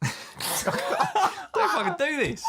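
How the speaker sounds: helpless high-pitched laughter through the words; laughing. Real, not acted. Guest put on the spot for his American accent, laughing. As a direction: struggle to speak through a fit of laughter. Cracking up before the accent attempt.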